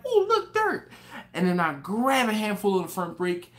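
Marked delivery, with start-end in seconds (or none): 0.1-0.9 very high, louder mock-excited exclamation; mock wonder. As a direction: squeal it like a distracted fool. Acting out his distracted reaction.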